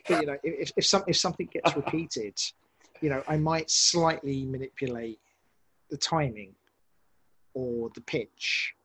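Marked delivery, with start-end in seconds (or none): none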